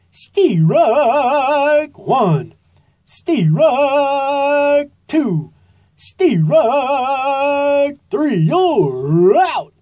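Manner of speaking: loud, high umpire calls; umpire yelling. A narrator's reading. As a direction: bellow the strikes like an umpire. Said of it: "yelled umpire Earl Eagle".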